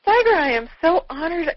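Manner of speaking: gush, overwhelmed at meeting your hero; starstruck. Retold or imitated. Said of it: "and I was like".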